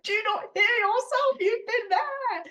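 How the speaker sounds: hammed-up exasperated cry, laughing through it; wife replaying her shouting at husband, half laughing; retold shout. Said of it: "And I'm like".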